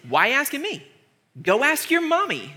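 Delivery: mock them like whining children; sarcastic, teasing brush-off; Elisha, sarcastic. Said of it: "both incredibly sarcastic".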